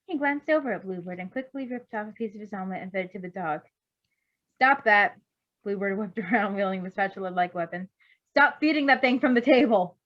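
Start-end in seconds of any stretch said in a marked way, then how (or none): 4.6-5.2 loud sharp "stop that"; sharp scolding. Acted. Bluebird scolding dog at table. 8.3-10.0 raised, exasperated telling-off; loud scolding. Acted. Bluebird scolding the doctor.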